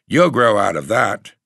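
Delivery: put-on a young lad's knowing comment; boy's remark. A narrator's reading. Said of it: "the boy commented".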